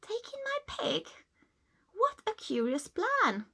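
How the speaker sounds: put-on high, incredulous old lady; baffled by the odd advice; puzzled surprise. Old lady doubting the wise man's plan, incredulous.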